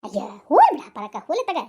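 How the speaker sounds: loud dismissive cry. Acted. Friend dismissing the complaint.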